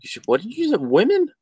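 high, squeaky disbelief; shocked squeal. Real, not acted. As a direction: shriek it in mock shock. Mock outrage at "woman.

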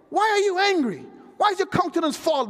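high-pitched, forceful questioning; insistent divine questioning. Acted. The Lord questioning angry Cain, high insistent challenge.

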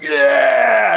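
loud, eager yell of yes; eager shout. Retold or imitated. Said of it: "is just like".

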